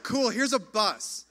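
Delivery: mildly hyped, loud and cheery; upbeat. Retold or imitated. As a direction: pitch it like a fun surprise plan. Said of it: "he was like".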